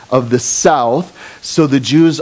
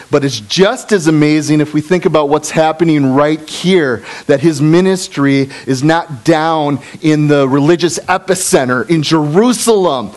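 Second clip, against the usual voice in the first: normal talking voice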